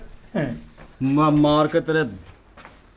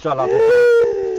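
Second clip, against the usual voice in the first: slow, much higher, louder call; drawn-out call; 10 dB louder, much slower than usual. Acted. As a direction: stretch it out as a mock summons. Calling thief to come.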